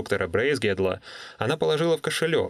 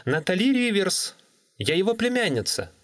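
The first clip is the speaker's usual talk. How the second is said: put-on higher, clear young woman; 10 semitones higher than usual. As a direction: speak up over a bad line. Natalie repeats on phone.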